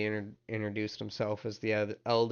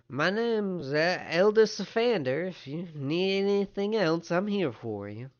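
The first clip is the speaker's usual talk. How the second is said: high-pitched elder character voice; obliging town elder; 8 semitones higher, 4 dB louder than usual. Acted. Town elder introduces himself to a visitor, high and obliging. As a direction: offer help in a high, measured voice. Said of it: "he kinda nods and goes".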